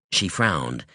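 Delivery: plain reading voice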